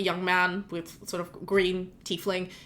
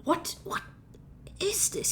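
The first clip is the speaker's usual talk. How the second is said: startled gnome bishop. Acted. Gnome bishop startled by a head on his desk, gasping. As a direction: jump back in shock at the head.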